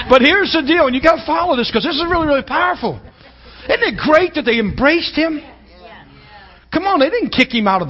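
high, loud preaching with shouted peaks; full-force preaching. Acted. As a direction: preach it hard to the congregation, slowing on key lines. Preaching resumes.

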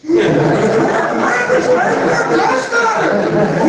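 slow, high, laughing through the words; laughing delighted echo. Retold or imitated. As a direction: retell his joy while laughing. Man's joyful reply, laugh heard.

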